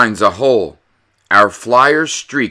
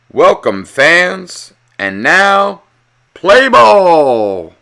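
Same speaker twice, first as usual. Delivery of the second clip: loud, high booming announcer call; stadium announcer; 8 semitones higher, 7 dB louder, much slower than usual. Acted. The announcer welcoming the fans, booming.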